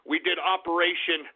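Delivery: ordinary delivery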